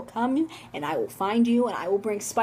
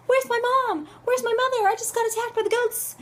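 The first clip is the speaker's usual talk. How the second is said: put-on high-pitched upset kid wailing for mom; mock-tearful little kid, much higher voice; crying child; 9 semitones higher than usual. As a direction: play a distressed child calling out for mother. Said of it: "I'm crying. I'm like".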